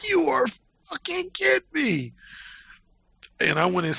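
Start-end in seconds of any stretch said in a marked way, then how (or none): 0.0-2.1 hammed-up stunned reaction; amazed brother. Brother seeing him with her keys, high amazement. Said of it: "he had a look".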